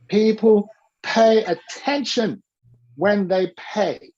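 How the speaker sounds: slow and weighty, leaning on "pay"; teacher stating a maxim for the class to repeat; slow emphatic rule. Shown as a demonstration. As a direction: lay the rule down slowly so it sticks.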